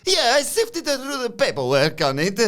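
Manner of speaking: high, slow put-on voice; lightly voiced eager helper; Luca voice. Luca reporting back to Tony, in a high, slow put-on voice. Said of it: "And Luca says".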